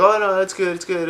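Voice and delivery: high, loud ingratiating reassurance; overly nice. Retold or imitated. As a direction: play the eager-to-please host, high-pitched. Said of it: "trying to be all nice".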